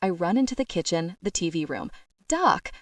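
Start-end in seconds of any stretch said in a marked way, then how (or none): 2.3-2.7 high, loud desperate call. Acted. Rose searching room to room for Doc, a loud high call. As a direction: yell his name across the house. Calling through the house.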